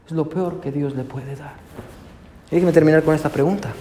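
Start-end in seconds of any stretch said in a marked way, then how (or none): 0.1-1.6 much softer repeat of the line; soft echo. Acted. Preacher repeats the key line softly to the congregation, hushed. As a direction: let it sink in quietly.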